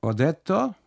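custodian speaking Italian. Acted. Custodian insists to the trembling boy, loud Italian voice. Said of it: "sagt der Kustode".

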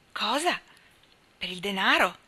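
astonished. A narrator's reading. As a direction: caught off guard, blurting it out. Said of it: "gridò Nat guardandolo sbalordito". (stupore, con un grido più acuto)

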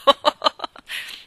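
breathy wheezing laugh bursting out; loud burst of laughter. Acted. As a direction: let the laugh explode.

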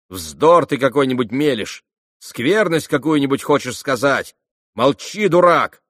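высоко, громко, резко обрывая; гневно заткни ему рот; сердитая отповедь. Acted. Грушенька обрывает Ракитина. (сердито, с нарастающим криком)

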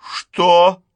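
loud, high startled question; choking on the word. A narrator's reading. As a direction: blurt it in shock. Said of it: "Шарлотта поперхнулась этим словом". (потрясённо, будто поперхнувшись)

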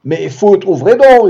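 high, loud yell. Acted. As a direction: yell it at the locked door. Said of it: "hurla-t-il". (hurlé, aigu et fort)

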